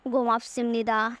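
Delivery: usual speaking voice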